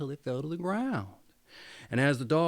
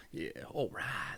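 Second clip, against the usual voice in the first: whispered; 4 semitones lower, 11 dB quieter than usual. Real, not acted. Settling in before reading.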